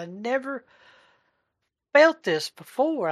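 high, drawn-out wonder with a pause midway; retold amazed joy. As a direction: relive the amazement, slowing on the last words. Retells overwhelmed joy to coworker.

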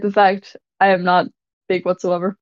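ordinary talk, nothing marked

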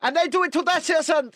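high mock Chinese accent. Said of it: "trying to do a Chinese accent".

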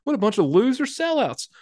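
hammed-up fan griping about sellouts; mocking a fan. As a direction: imitate a disgruntled listener, whining. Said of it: "you think it's like".

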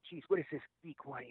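scornful, under the breath; muttered thought. Retold or imitated. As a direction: mutter a private sneer. Private scornful thought at fan.

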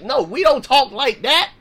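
usual speaking voice

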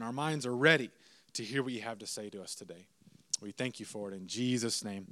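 hushed, low-pitched prayer voice; opening prayer. Real, not acted. Leads the congregation in prayer.

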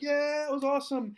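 high mimicked cheer; excited students. Classmate hails the teacher's comeback, high mock excitement. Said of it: "I walk back in the class".

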